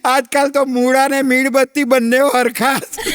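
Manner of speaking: mock crying. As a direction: fake weeping over her sorrows. Mock tearful lament about husband. (બનાવટી રડતો અવાજ)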